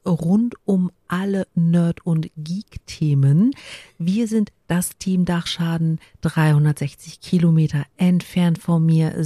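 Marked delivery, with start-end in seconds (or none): 0.1-3.5 slow, halting read-out. Shown as a demonstration. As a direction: read it slowly, word by word. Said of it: "Und ich spreche so komisch".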